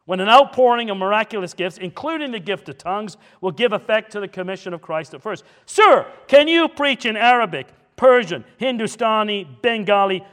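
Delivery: loud, high, overbearing rebuke. Retold or imitated. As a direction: berate the young upstart at full volume. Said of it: "said it in a raised voice".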